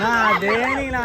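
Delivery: slow, high, loud shout; loud drawn-out cheer. Real, not acted. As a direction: stretch it out and shout.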